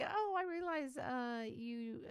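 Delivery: high, awkward stammering excuse; flustered apology. Retold or imitated. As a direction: backpedal in embarrassment. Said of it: "I'm like".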